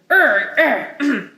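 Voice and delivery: short growled grunts; angry grunts. Shown as a demonstration. Teacher modeling an angry sound for the class, gruff grunting. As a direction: grunt like you're cross. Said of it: "For angry".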